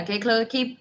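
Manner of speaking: usual speaking voice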